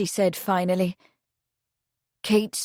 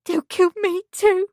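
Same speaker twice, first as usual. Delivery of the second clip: put-on high, loud terrified young girl; frightened; 11 semitones higher, 5 dB louder than usual. A narrator's reading. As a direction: blurt it out in fear. Meg frightened.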